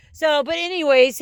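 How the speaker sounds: normal talking voice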